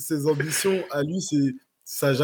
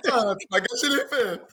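excited high outburst; 12 semitones higher than usual. Real, not acted. Punchline stolen by co-host.

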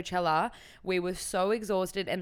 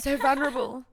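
laughing; 5 semitones higher, 5 dB louder than usual. Real, not acted. Joking about kids' shows.

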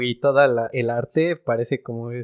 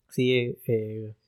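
normal talking voice

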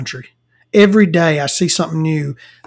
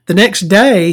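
normal talking voice

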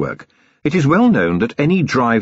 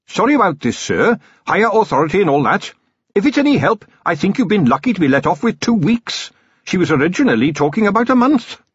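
high, brisk voice of a subordinate; earnest young captain, apologetic; 11 semitones higher than usual. Acted. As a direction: apologise brightly to your commander, pitched high.

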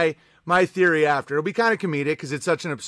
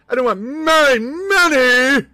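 much higher, louder, slow whining; whiny mock wail; 6 semitones higher, 6 dB louder, slower than usual. Streamer mocking a viewer who defends his money. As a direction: wail it like a spoiled child.

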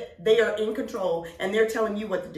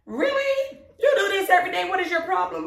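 incredulous family; 10 semitones higher, 3 dB louder than usual. Retold or imitated. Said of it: "they're looking at me like".

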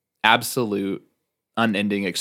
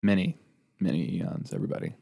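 sultry narrator; 6 dB quieter than usual. Acted. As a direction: breathe it close to the mic. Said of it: "This soft, sultry voice".

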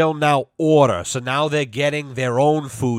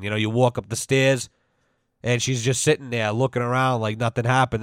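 ordinary talk, nothing marked

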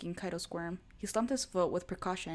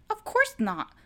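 much higher, louder overcompensating denial; too loud denial; 10 dB louder, slower than usual. A narrator's reading. Scared boyfriend denying fear, far too loud. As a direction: blurt it to prove you're brave. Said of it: "Kaido said a bit too loud".

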